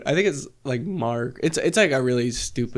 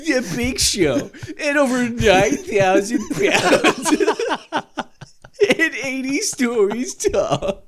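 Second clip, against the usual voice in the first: higher, slow, laughing list-video narration; clickbait video narrator; 9 semitones higher, slower than usual. Acted. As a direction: hype it like a top ten video. Joining mock narrator bit.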